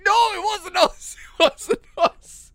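high, loud denial breaking into laughter; laughing denial. Retold or imitated. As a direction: deny it while cracking up. Caught, denying to teacher.

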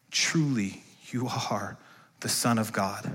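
very soft, slow reverent confession; hushed, reverent awe; awed hush. Acted. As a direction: breathe it out in wonder.

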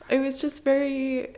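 usual speaking voice

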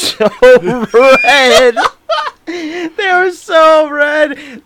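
slow, high, drawn-out words breaking into laughter; loud amused emphasis through laughter; laughing hard. Real, not acted. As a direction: stretch out "so red" as you crack up. Cracking up describing red eyes.

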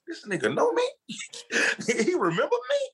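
high, disbelieving, half-laughing; stunned inner voice. Retold or imitated. Thinking to himself when Nick recognizes him, high stunned disbelief. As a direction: sound floored that he's recognized. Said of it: "I'm thinking to myself".

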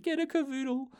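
silly high-pitched suggestion; loopy urging. Acted. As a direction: pipe it up in a squeaky little voice. Acts out loopy self advising her.

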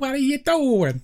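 high, drawn-out New York drama queen; exaggerated New York accent. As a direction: ham up a slow, high-pitched old New York accent. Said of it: "Tallulah was pushing it".

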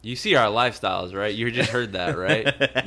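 usual speaking voice

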